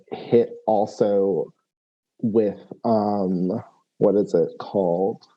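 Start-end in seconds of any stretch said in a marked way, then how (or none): none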